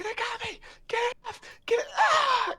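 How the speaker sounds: high terrified shriek; dying scream. Acted. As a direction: scream as you're torn apart. Guard grabbed by monsters.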